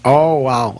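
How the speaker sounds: ordinary talk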